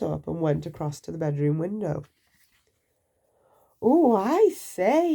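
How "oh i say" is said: slow, high and loud oh; drawn-out wonder; waking to see the snow; amazed. Acted. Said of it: "he said".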